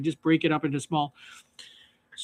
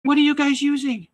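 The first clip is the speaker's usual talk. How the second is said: put-on kid's question; 8 semitones higher, 4 dB louder than usual. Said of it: "they're like".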